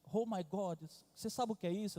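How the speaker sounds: put-on a small girl testing her dad; little girl asking. Daughter quizzes her father.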